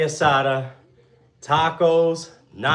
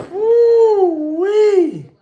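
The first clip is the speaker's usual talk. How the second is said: loud, high-pitched woo-wee, drawn out and falling; 12 dB louder than usual. Real, not acted. Streamer whoops at twenty-five push-ups, loud and high. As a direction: whoop it way up high and let it fall.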